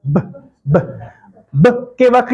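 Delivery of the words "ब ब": ब said low and soft, with no puff of air; the deliberately wrong ब, dropped low and quiet; wrong unaspirated sound. Shown as a demonstration. Teacher showing students the wrong, unaspirated ब. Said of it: "दूसरा sound अगर mispronounce करूंगा".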